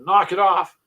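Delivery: mock yell at the kids; retold yell. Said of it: "I yelled at my boys".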